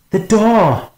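high-pitched urgent yell; panicked high cry of warning. A narrator's reading. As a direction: yell it out in alarm, voice shooting up. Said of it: "Stark yelled".

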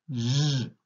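J drawn out as "zhhh"; isolated consonant sound. Shown as a demonstration. Teacher giving first sound of gilet, sustained J. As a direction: hold the J as a buzzing consonant. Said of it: "C'est le son".

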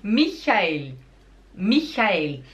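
"Michael" with breathy German CH; German pronunciation. Shown as a demonstration. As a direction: say "Michael" as MI-kha-el, clear final L. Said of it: "em alemão seria".

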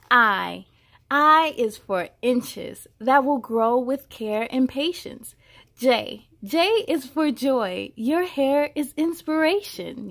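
put-on bright, measured read-aloud voice for a child; storybook reading. A narrator's reading. Reading a hair alphabet book aloud to a child, storybook voice. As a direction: read each line out like a picture book.

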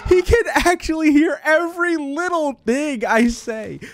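high, slow, drawn-out complaint; exasperated high wail. Real, not acted. Player caught because he's heard, wailing in exasperation. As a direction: wail it in disbelief.

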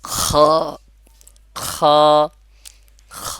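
isolated "খ" with long friction at the top of the throat; খ from upper throat. Shown as a demonstration. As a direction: rasp each "খ" from where the throat begins, pausing between. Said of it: "গলার শুরু থেকে".

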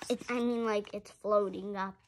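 usual speaking voice

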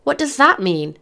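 high, impatient question; exasperated, pitch jumping up; snorting impatience. A narrator's reading. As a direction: ask it with a mild snort of scorn. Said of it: "Tommy snorted".

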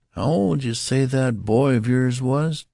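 high-pitched, curious clerk voice; curious demand. A narrator's reading. Clerk questioning the father about his boy, curious. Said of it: "demanded the clerk curiously".

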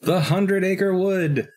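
slow, high, drawn-out announcement; dramatic reveal. Real, not acted. Answering his co-host's quiz with a slow, high dramatic reveal. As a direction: savor each word like a big reveal. Asked where the book goes.

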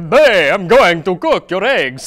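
loud, slow, hammed-up Italian accent; mock Italian accent. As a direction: exaggerate a bad Italian accent loudly.

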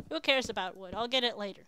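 ordinary delivery

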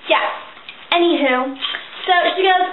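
ordinary delivery

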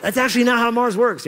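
high, loud voice of a correcting listener; loud, high-pitched interjection from the pews; correcting churchgoer. Acted. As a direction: pipe up high to correct the preacher. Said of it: "and it's like".

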